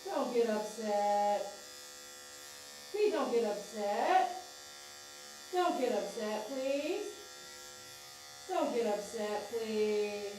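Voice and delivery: slow, drawn-out coaxing chant; sing-song plea. Real, not acted. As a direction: soothe the dog with a lilting, repeated plea. Soothing the dog with chanted plea.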